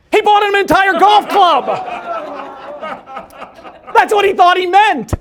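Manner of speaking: loud, high, thrilled burst at the punchline; excited shout. Real, not acted. Speaker shouting the king story's punchline to the audience, excited. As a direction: shout the twist to the crowd.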